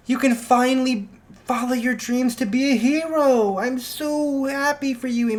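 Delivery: high, overjoyed mom voice; gushing proud mother. Acted. Mom rushing to her son, gushing.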